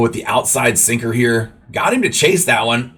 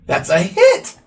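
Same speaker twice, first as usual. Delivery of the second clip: much higher, astonished burst; surprised yelp; slower than usual. Real, not acted. Gamer amazed his own pitcher got a hit, surprised. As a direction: squeak it out in disbelief.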